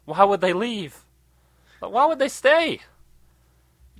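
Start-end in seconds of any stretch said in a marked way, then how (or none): 0.0-2.7 mock bewildered. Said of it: "everybody's like".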